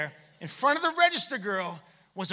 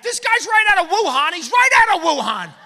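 loud mock panic, higher; panicked shoppers; 7 semitones higher, 11 dB louder than usual. Shoppers freaking out at a sneeze, loud mock panic. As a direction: yell it like a spooked shopper. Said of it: "They were like".

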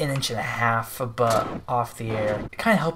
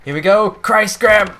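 loud, high burst of hype; excited shout; 7 semitones higher, 9 dB louder than usual. Real, not acted. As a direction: yell it as you launch. Launching the trick, shouting.

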